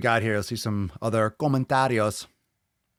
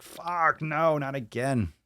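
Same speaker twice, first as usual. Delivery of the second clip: mock dismay; 6 semitones higher, much slower than usual. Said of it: "my eyes and my brain is just like".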